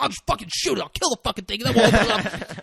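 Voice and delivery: high, laughing, fast threat with a gun; half-laughing as he threatens to shoot; manic threat. Retold or imitated. Gun-waving character threatens the dog.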